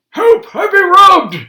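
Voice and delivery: high, loud cry for help; old man bellowing for help up the stairs; Grandpa shouting. Acted. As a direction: yell it as a panicked old man robbed. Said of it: "we heard Grandpa shouting".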